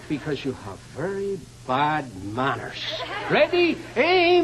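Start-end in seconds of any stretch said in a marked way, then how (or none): 3.3-4.4 high, loud firing squad call; loud military countdown; shouted command. Acted. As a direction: bark out ready and aim.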